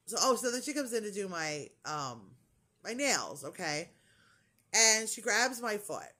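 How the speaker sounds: ordinary talk, nothing marked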